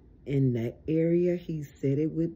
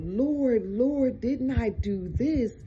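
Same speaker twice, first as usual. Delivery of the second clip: high, wailing mock plea to the Lord; crying out in mockery; 7 semitones higher, 5 dB louder than usual. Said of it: "When they cry out".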